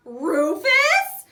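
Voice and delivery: high, loud, hopeful question; suddenly thrilled at the name; excited gasp. A narrator's reading. Hears lost toy found.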